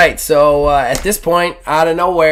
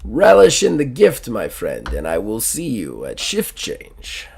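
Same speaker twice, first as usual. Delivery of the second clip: put-on Russian accent. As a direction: take measured leave as the Russian. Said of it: "our good friend the Russian".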